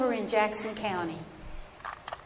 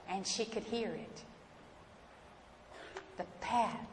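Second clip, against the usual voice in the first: hushed; 4 semitones lower, 11 dB quieter than usual. A narrator's reading. Hushed suspense in dark woods.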